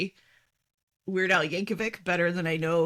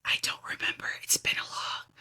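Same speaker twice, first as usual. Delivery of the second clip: whisper; 6 dB quieter, faster than usual. Pretend. Whispering she forgot spoilers.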